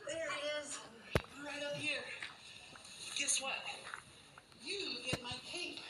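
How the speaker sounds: put-on high, sing-song voice for a little kid; doctor cheering up a nervous child, bright and high; talking to a small child.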